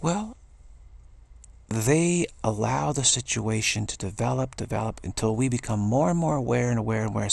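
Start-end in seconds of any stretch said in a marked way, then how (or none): none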